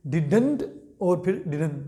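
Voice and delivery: didn't said first with a full final t, then didn't with the t dropped as didn; two pronunciations. Shown as a demonstration. Teacher shows students both ways to say didn't. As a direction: contrast didn't with the t, then didn't without it. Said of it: "दोनों तरीकों से आप इसको pronounce कर सकते हैं".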